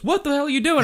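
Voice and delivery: high, alarmed retold yell; startled hiker. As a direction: act out a hiker scared out of his wits. Retold startled hiker yelling at him.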